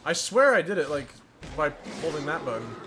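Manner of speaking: ordinary delivery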